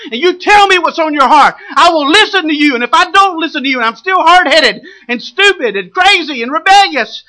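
impassioned sermon crescendo; full-force preaching. Real, not acted. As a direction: preach it high and insistent, punching key words.

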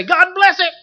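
high, offhand "God bless it"; a quick, high mock blessing. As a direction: toss off a careless blessing. Mocking hasty blessing.

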